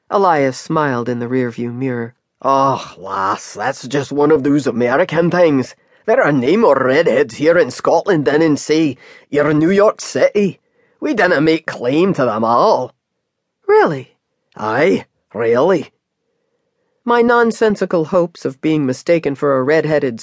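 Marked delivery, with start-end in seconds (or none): 2.4-12.9 teasing Scottish driver setting her straight; Scottish cab driver. Acted. Scottish driver voiced by reader. 13.7-14.2 high, louder surprised question. Acted. Surprised at redhead news. 14.6-15.9 dry Scottish confirmation, lightly voiced; Scottish cab driver.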